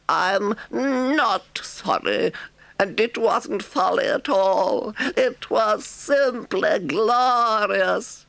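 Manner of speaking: high, slow, boastful Toad voice; drawn-out and proud, a stubborn toad; defiant Toad. A narrator's reading.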